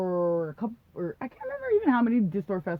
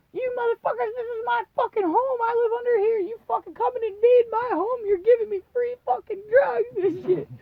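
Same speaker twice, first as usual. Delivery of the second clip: put-on much higher, drunken belligerent rant; 13 semitones higher, 3 dB louder than usual. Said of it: "I was just all belligerent".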